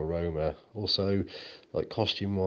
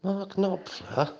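shocked disbelief; 13 semitones higher, much slower than usual. Acted.

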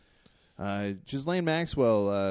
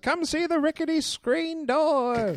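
carnival barker; 11 semitones higher than usual. Acted. Barker hyping the rickety screen door.

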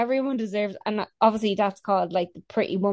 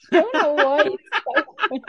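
laughing; 9 semitones higher, faster than usual. Real, not acted. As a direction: break into giggles on the words. Laughing at the quote.

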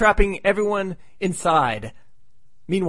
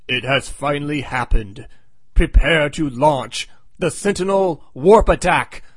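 slow, portentous villain announcement, building louder; alien cyborg; 4 dB louder than usual. Retold or imitated. Alien waking in a crypt orders the launch, grand villain voice. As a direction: intone it like an awakened machine creature, ending loud and slow. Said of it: "half-cyborg-looking alien creature wakes up".

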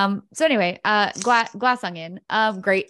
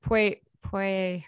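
usual speaking voice

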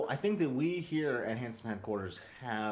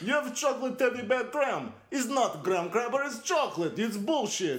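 higher, louder Russian brute taunt; barbarian; 10 semitones higher, 5 dB louder than usual. Retold or imitated. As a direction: scoff like a Russian strongman. Putin taunt bit.

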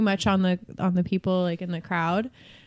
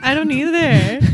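higher, slow put-on voice; mock patient; 7 semitones higher, 4 dB louder, slower than usual. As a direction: join the bit in a silly voice. Joining the improv scene.

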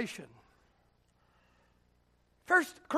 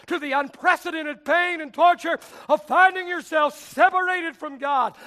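full-force preaching; 5 semitones higher, 9 dB louder than usual. Real, not acted. Climactic preaching run.

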